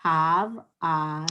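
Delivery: Tav then ah, each part said apart; teacher modelling Tav, ah for students; sounding out letters. Shown as a demonstration. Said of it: "like that".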